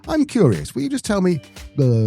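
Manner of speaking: high-pitched American podcaster; a hint of an American podcast host. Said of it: "people going".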